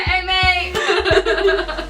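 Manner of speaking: high, loud, laughing fake British accent; mock British accent. Friend pretending to come home British, mock British accent. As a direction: exaggerate a British greeting while cracking up. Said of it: "pretend to be, have a British accent".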